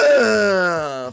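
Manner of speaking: loud, high drawn-out "ah"; long villainous wail of glee; hammed-up gloating cry. Streamer hamming up delight at donated money, loud gloating cry.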